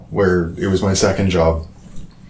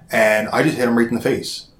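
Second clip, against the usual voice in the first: ordinary talk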